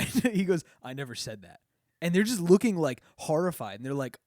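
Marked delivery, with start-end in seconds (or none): 0.8-1.6 flat, quiet, deadpan denial; quiet deadpan I never said that; dead serious denial. Retold or imitated. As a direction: deny it with a straight face. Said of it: "he acting dead serious".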